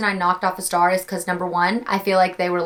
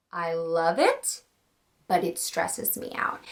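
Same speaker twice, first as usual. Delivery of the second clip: slow, softer, trailing to a whisper; hushed confession; 4 semitones lower, 7 dB quieter, slower than usual. Real, not acted. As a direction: admit it under your breath. Confessing reading stresses her.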